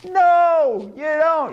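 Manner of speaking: high, drawn-out exclaimed no; emphatic no. Acted. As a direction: cry out a long, high refusal. Emphatic answer to own question.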